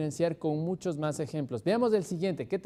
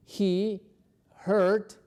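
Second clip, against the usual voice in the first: "hurt" said higher and louder, split off by a pause; stress on hurt; 4 semitones higher, 4 dB louder than usual. Shown as a demonstration. Teacher stresses the verb "hurt" for learners, louder and higher. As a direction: hit the verb "hurt" hard on its own. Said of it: "este es el verbo que significa lastimarse".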